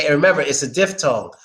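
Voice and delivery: ordinary talk, nothing marked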